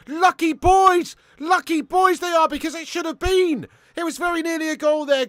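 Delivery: high, loud, fast play-by-play of a near goal; mock football commentator yelling about a shot off the post; excited commentator. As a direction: scream the post hit like a TV commentator. Mock football commentary on free kick.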